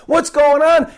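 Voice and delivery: high, loud frightened outburst; startled disciples yelling in panic; scared disciples. Acted. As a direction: cry out in sudden fear. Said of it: "and they're like".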